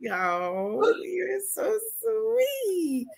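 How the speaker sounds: slow, touched "so sweet"; touched and tender. Real, not acted. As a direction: melt at the compliment. Touched by compliment.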